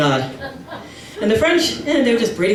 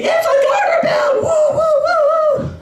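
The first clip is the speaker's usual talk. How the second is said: very high squealing woo; screaming old ladies. Retold or imitated. Old aunts at the bridal shower, high excited squeals. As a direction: shriek it like rowdy old aunts. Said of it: "laughing and screaming".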